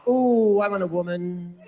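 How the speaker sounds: drawn-out, swooning mock longing; mock straight man. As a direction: coo it mockingly, starting high. Mocking what straight men want.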